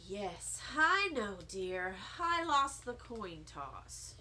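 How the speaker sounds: put-on tired, dry old lady; kind of annoyed. A narrator's reading. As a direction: sigh it out, a bit annoyed. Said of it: "kind of annoyed".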